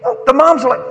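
ordinary delivery

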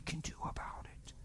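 intense whispered declaration; hushed aside to the congregation. Real, not acted. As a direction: whisper it with conviction. Preacher drops to whisper.

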